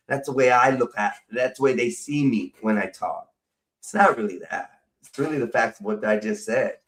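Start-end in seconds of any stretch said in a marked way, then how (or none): none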